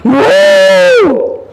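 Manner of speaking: a stuck cow bellowing in frustration; bellowing moo. Acted. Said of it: "She shook her head and bellowed".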